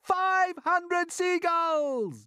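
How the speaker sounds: high, loud, slow tally; shouted. A narrator's reading. James shouting the tally to the crew. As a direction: boom out the count. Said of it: "James shouted".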